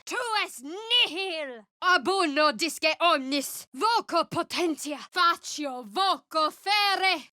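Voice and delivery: high, forceful, drawn-out spell cries; commanding casting chant; spell incantations. Acted. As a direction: call down power with each word.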